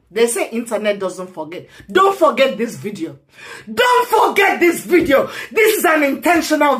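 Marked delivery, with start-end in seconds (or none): 3.7-6.8 higher, shouted rising warning; angry shout. Real, not acted. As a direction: yell it at the viewers. Rant, repeats line louder.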